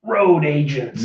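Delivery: slow, drawn-out show title in a put-on voice; hammed-up title announcement, words stretched out; throat-straining podcast title voice. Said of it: "do the whole thing in this voice".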